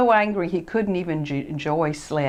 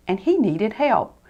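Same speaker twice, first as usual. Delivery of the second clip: plain reading voice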